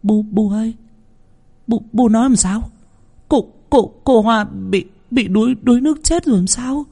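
high, stuttering panic; stammering terror. A narrator's reading. Daughter asking her mother about the death, stammering in terror. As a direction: stammer it out, terrified. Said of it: "Cô thất kinh ú ào ú ớ".

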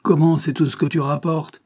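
angry, incredulous outburst; furious. A narrator's reading. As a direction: scold the child in outrage. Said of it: "se leva furieux".

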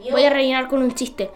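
ordinary talk

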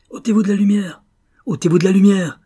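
high, loud, urgent shout; repeated panicky command at high pitch; frantic cry. A narrator's reading. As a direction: shout frantically to clear the line. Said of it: "s'écria Rosenthal avec frénésie".

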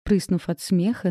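ordinary delivery

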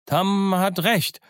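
high, drawn-out agreement; high-pitched pirate heckle; protesting pirate. Acted. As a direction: back him up in a high, slow call. Mutinous pirate chimes in high.

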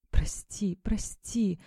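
a soft, broken whisper; whispered apology. A narrator's reading. Injured girl begs forgiveness from her friend in a whisper. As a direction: breathe the words out, barely voiced. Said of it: "зашептала вдруг Эмма".

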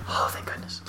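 hushed whisper of relief. Retold or imitated. Girlfriend hiding under the bed, whispered relief. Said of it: "She's like".

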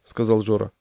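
ordinary delivery, nothing marked

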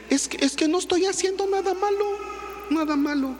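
high, whining "no estoy haciendo nada malo"; a teen making excuses in a high voice; whiny son. Retold or imitated. Preacher voicing a defensive teenager, high and whiny. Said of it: "y dices".

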